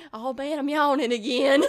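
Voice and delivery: loud, laughing mock yawn complaint; laughing mimicry. Teasing co-host about yawning with eyes closed, laughing imitation. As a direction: mimic your friend through helpless laughter. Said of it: "the fact that you closed your eyes".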